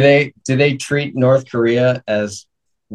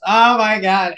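1 mock dismay; 10 semitones higher than usual. Old man realizes, then grieves.

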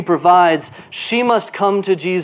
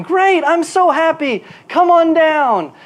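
much higher, slowed, overjoyed mock welcome; mock gushing welcome; 10 semitones higher, 4 dB louder than usual. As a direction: gush like a delighted host, hammed up. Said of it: "He doesn't respond by going".

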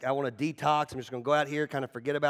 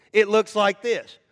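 ordinary delivery

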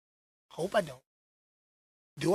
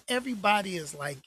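usual speaking voice

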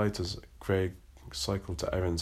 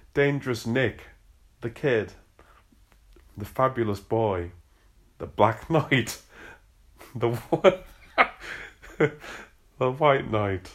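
real giggles breaking the list; laughing through it; 4 semitones higher, 5 dB louder than usual. Real, not acted. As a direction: try to read, losing it to laughter.

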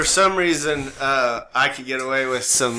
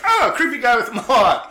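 high, louder mock-alarmed bystander; 8 semitones higher, 6 dB louder than usual. Everybody else spotting the mohawk, mock alarm. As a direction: recoil in comic alarm, pitched up and loud. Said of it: "Everybody else is like".

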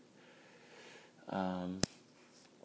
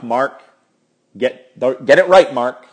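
self-scolding; 2 semitones higher, 10 dB louder, slower than usual. Real, not acted. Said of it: "scolds himself".

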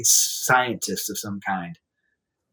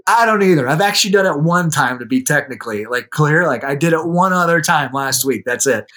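excited laughing; 4 semitones higher, 6 dB louder than usual. Retold or imitated. Retelling awkward meeting, laughing.

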